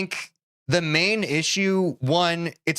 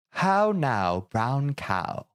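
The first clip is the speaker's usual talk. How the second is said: mock British accent; much slower than usual. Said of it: "I like to do it".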